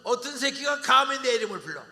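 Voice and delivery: how dare anyone call me; scoffing proud man; arrogant outburst. Acted.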